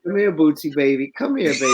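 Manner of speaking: put-on high cooing baby talk. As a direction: coo at him like a baby. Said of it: "a little baby voice to really F with him".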